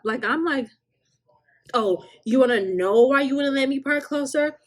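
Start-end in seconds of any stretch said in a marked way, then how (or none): 1.7-4.6 higher, louder snide accusation; mocking accusing guest.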